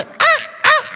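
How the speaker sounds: imitated crow caw. Shown as a demonstration. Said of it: "¿sabe cómo hace el cuervo?".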